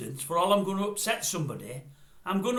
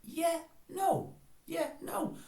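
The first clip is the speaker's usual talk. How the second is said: puzzled; 7 semitones higher, 11 dB quieter, much slower than usual. Shown as a demonstration. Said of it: "I was never taught that".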